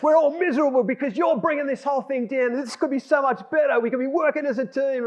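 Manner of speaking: high-pitched heated telling-off; blew up angrily. Retold or imitated. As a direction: re-enact chewing out a workmate. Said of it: "it came to a head and I blew up".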